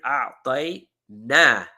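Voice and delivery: teacher stressing the na suffix in أعطينا. Shown as a demonstration. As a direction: lean on the na ending of أعطينا. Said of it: "The na here".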